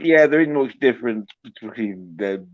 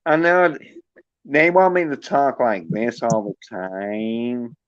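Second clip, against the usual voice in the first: normal talking voice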